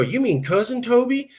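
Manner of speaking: high, drawn-out Texas drawl question. Retold or imitated. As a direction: imitate a Latin cousin's Texas drawl. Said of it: "they have the Texas drawl".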